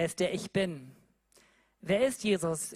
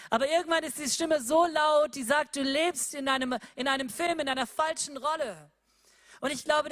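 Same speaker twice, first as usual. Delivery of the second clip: preaching at full force; 5 semitones higher than usual. Acted. Preacher retelling Moses to the congregation, at full force. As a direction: preach it, rising and pressing on.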